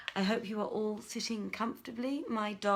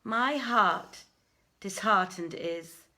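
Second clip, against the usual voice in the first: louder, declaiming storyteller voice; dramatic story reading; 5 dB louder than usual. Acted. Reading the Arabian Nights to a lockdown audience, loud declaiming narration. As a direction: read the tale out grandly to listeners.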